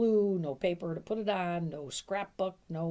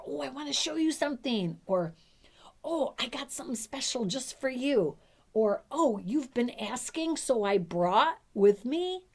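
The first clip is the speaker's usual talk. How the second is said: higher and louder, delighted; enthusiastic invitation; 7 semitones higher, 6 dB louder than usual. Shown as a demonstration. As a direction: sell it like a wonderful surprise. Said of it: "See the difference?".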